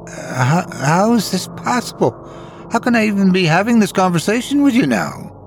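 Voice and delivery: high-pitched, stammered disbelief; frantic. A narrator's reading. Ghost woman who has just seen her fatal wounds, frantic. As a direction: start on a stammer, then rise high in alarm. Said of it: "she asked frantically".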